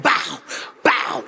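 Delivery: low, booming "bow"; deep impact noises; imitated ground smacks. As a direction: mimic heavy gorilla thumps with the voice. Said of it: "smacking the ground".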